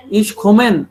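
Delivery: "komme" wrongly closed as "kommen"; wrong N ending. Shown as a demonstration. Teacher showing the mistake, "komme" said as "kommen". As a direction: end "komme" on a held N.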